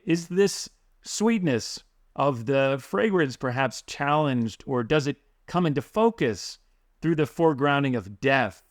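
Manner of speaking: ordinary talk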